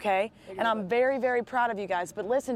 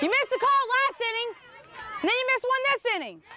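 sharp, raised protest at the umpire; arguing with the umpire; 9 semitones higher than usual. Real, not acted. Coach yelling at the umpire over a missed call, angry. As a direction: push back hard on a bad call.

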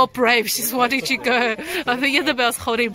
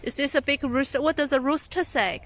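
normal talking voice

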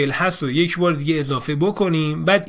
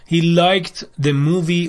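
slow dictation read; 3 dB louder, much slower than usual. Shown as a demonstration. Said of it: "reading answers slowly".

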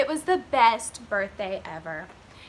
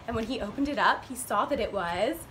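usual speaking voice

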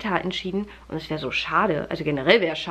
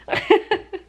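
amused laugh; 11 semitones higher, 5 dB louder than usual. Real, not acted. Laughing at the dish-soap idea.

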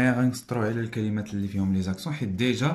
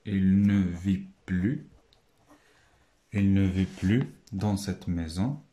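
slow reading; 3 semitones lower, slower than usual. Shown as a demonstration. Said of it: "Donc il".

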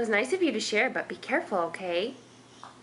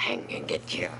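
monster voice. Pretend. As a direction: drop into a deep pretend monster to tease the baby. Peekaboo chase with baby.

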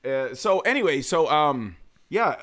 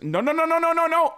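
much higher panicked no no no; a lady calling out in alarm, pitched way up; dog owner crying no; 13 semitones higher than usual. Retold or imitated. Dog owner yelling as her dog charges a kid, high and urgent. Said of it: "all I hear out the corner of my ear is".

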